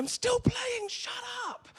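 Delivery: imitating his own frantic outburst mid-match; mock angry shout. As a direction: snap back at the noisy teammates. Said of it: "And I'm going".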